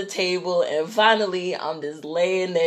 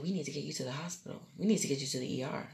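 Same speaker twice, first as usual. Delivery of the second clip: quick, hushed urgency of a doctor; low-key, rushed medical warning; tom baixo e urgente; 4 semitones lower, 14 dB quieter, much faster than usual. Retold or imitated. Retells doctor urging hospital.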